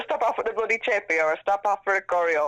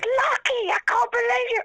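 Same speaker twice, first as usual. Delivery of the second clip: much higher mock-outraged voice; indignant boyfriend; 3 dB louder than usual. Wife retelling her jailed partner's complaint, in a high mock-indignant voice. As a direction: play him huffy and put-upon, pitched way up. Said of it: "And then he goes".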